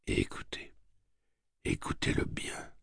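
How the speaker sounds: hushed, breathy mutter under the breath. A narrator's reading. Overholser muttering to Slightman, hushed whispered mutter. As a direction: mutter it low so only the room's edge hears. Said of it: "marmonna Overholser".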